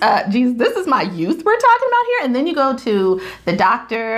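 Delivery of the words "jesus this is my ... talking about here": exasperated plea. Retold or imitated.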